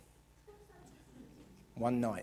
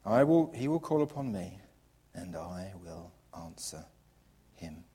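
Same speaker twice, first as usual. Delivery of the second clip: soft sincere reading; 8 semitones lower, 4 dB quieter than usual. Acted. Right reading, softly.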